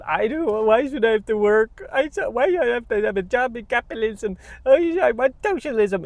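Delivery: high whiny mock voice; mocking whiny socialists. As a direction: whine it high like a spoiled kid. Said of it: "they're talking about".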